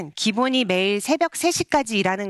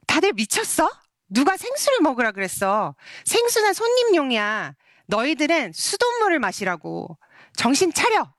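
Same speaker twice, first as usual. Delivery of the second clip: retold angry outburst, pitched up; angry head chef yelling; 5 semitones higher than usual. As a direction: imitate a chef telling off the juniors. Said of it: "막 이렇게 소리를 지르는 거예요".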